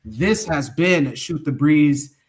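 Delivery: ordinary talk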